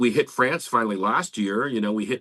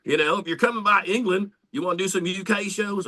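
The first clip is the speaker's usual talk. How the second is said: higher, mock English promoter accent; lightly done English accent pitched up; put-on English accent; 6 semitones higher than usual. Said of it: "I'm just doing a completely different accent".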